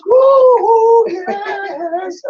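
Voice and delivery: high excited woo, then yes; high whoops. Real, not acted. Jubelnde Hype-Rufe.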